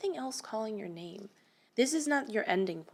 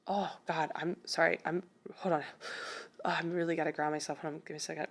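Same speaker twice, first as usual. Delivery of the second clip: overcome with emotion; 3 semitones lower, faster than usual. Real, not acted. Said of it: "I'm getting emotional".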